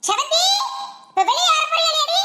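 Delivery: high, rising call from outside. Acted. Mother outside summoning her daughter, calling out. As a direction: holler for your daughter to come out. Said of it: "யார் இப்படி கத்துறது".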